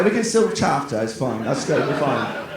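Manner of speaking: ordinary talk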